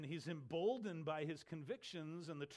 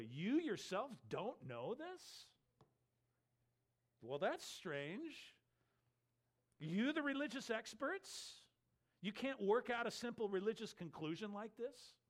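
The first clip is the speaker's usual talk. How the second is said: emphatic incredulity; 5 semitones higher than usual. Shown as a demonstration. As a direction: question the experts in pointed disbelief. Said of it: "You can say it this way".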